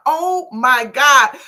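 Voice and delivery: slow, loud "Oh my God"; drawn-out thrilled exclamation; delighted gasp. Retold or imitated. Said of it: "I said".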